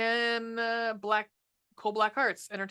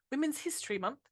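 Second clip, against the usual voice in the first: put-on a light, quick British accent on this phrase, pitched higher; 6 semitones higher, 4 dB quieter than usual. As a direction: slip into a half-hearted British accent. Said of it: "I don't know why I did that British".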